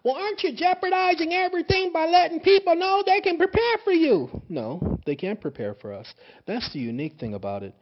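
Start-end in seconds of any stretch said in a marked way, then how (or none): 0.0-4.4 high, loud mocking whine of the doubters; mocking objector. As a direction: squeak it out like a smug critic. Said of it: "Now people say".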